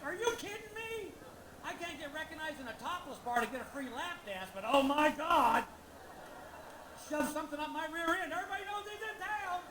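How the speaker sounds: high sarcastic tirade climbing to a shout; sarcastic rant. Retold or imitated. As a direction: build from griping to yelling. Ranting about getting recognized.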